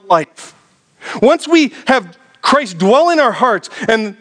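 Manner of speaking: high-pitched, driving sermon delivery; fervent preacher, pitched well up; impassioned preaching. Real, not acted. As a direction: preach it with rising conviction. Preaches with raised intensity.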